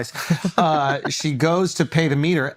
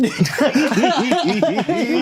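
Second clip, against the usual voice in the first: can't hold the laugh in; big laugh; 9 semitones higher than usual. Real, not acted. Laughing at the guy's reply.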